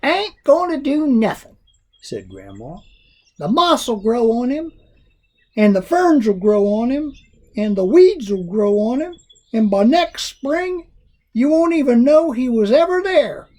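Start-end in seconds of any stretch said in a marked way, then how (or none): 0.0-1.6 put-on high stubborn old lady; Grandma's voice. A narrator's reading. Grandma answering Jack, in a high old lady voice. 3.4-13.5 put-on high stubborn old lady; Grandma's voice. A narrator's reading. Grandma answering Jack, in a high old lady voice.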